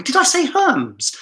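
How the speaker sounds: high-pitched disbelieving question; incredulous. Real, not acted. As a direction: ask it in startled disbelief, pitch leaping up. Disbelieving question about own slip.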